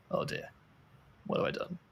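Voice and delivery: hushed, half-voiced mock regret; mock dismay. Developer to himself after running the upgrade, hushed mock dismay. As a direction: mutter it softly, as if dawning on you.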